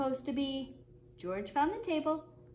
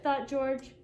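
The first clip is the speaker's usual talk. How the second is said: usual speaking voice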